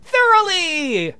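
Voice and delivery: high, slow, drawn-out delight; hammed-up delighted girl. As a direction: gush it with joy. [name] delighted after dancing.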